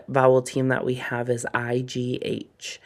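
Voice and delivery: ordinary delivery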